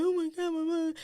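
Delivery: high mock wail; high-pitched retold sobbing cry. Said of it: "I'm crying over there".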